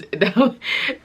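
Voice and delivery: bright burst of laughter; short laugh. Real, not acted. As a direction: laugh breaking into the story. Laughing mid-story about midwife.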